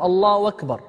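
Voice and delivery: الله أكبر with a w glide replacing the hamza, "Allahu wakbar"; hamza turned waw. Shown as a demonstration. Teacher showing students a variant takbir, أكبر said as "wakbar". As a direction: run it together as "Allaa-wakbar". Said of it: "يقلب الهمزة واوا".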